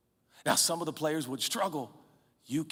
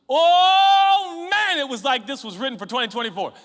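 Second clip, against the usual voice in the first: higher, loud emphatic reading; preached scripture; 10 semitones higher, 10 dB louder than usual. Acted. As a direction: proclaim the verse at full force.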